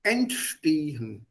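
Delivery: entstehen stretched out slowly; slow vocabulary-drill "entstehen"; word picked out. Shown as a demonstration. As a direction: say "entstehen" slowly and deliberately for learners. Teacher isolates word for the class.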